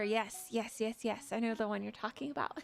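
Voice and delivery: ordinary delivery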